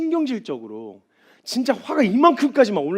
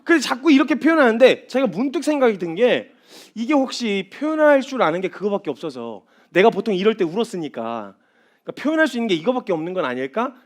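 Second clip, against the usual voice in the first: ordinary talk, nothing marked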